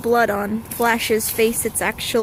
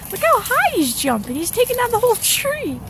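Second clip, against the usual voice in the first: excited; 11 semitones higher, 4 dB louder than usual. Real, not acted. Excited watching dog jump.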